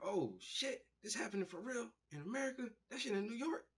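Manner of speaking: high, fast, stunned; shocked disbelief. Retold or imitated. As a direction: gasp it out in disbelief. Reacting to 9/11 news.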